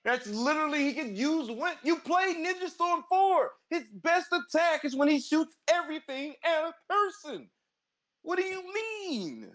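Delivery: high, slow disbelief; can't believe what he's hearing; incredulous. Real, not acted. Incredulous in argument about wind.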